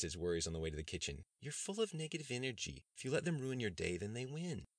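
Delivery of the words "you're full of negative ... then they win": higher, lightly effeminate soothing voice; calm your upset lover down; soothing Bree. A narrator's reading. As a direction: partner easing his worries.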